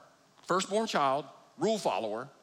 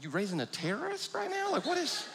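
higher, incredulous mock question; mock shocked; 13 semitones higher than usual. Uncle asking the dad in mock shock, higher pitch. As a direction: pitch it up in disbelief.

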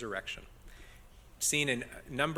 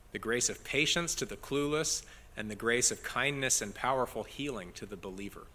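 normal talking voice

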